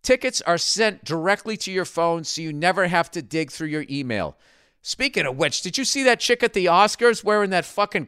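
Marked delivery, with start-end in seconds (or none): 0.0-4.4 upbeat, higher sponsor read; punchy, higher ad voice. Acted. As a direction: sell it like a radio ad. Host reads sponsor ad.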